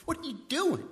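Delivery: sudden high outburst from the master; the master turns on his servant in anger, high and sharp; angry master. Acted. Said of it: "it's like in anger".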